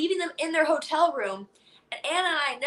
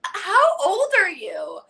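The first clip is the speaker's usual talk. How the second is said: high, drawn-out disbelief; incredulous, stretched-out question; shook; 7 semitones higher, 5 dB louder, much slower than usual. Retold or imitated. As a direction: imitate a stunned friend, slow and loud. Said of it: "sitting there shook".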